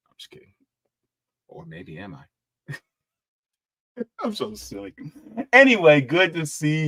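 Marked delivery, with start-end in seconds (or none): 0.1-4.9 coy whisper. Pretend. Teasing aside to a viewer.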